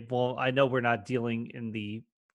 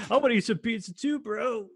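stoner; 8 semitones higher than usual. Retold or imitated. Mocking high stoners.

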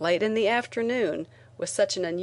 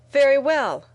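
loud, sharp and high; angry; 7 semitones higher, 9 dB louder than usual. A narrator's reading. Reporter to her editor, angrily refusing defeat. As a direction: snap it back in anger. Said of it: "I said angrily".

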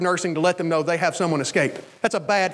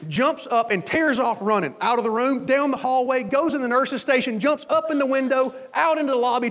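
usual speaking voice

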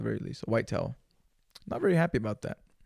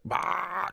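dry heave; 8 semitones higher than usual. Retold or imitated. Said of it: "just dry heaving".

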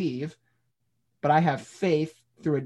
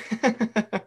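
high delighted laugh breaking out; burst of laughter; 7 semitones higher than usual. Real, not acted. Laughing at the book title Does God Really Like Me, high delighted laugh.

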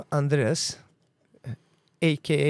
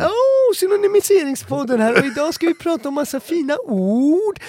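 high, loud showman intro; over-the-top radio host welcoming listeners; hammed-up podcast host; 9 semitones higher, 7 dB louder than usual. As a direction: announce the new show like a grand host.

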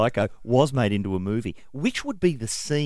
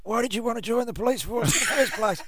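higher-pitched English geezer voice; Cockney geezer copper, said twice; Tony Martin impression; 10 semitones higher than usual. Shown as a demonstration. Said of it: "Let me just do my Tony Martin".

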